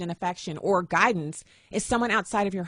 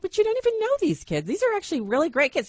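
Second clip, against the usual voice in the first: indignant protest; 10 semitones higher than usual. Retold or imitated. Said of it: "And I'm like".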